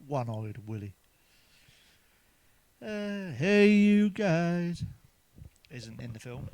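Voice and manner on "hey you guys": higher, louder and very slow put-on voice; Goonies Sloth voice. Comic quoting a Goonies line in a slow, loud put-on voice. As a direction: stretch it out in a slow, loud cartoonish call.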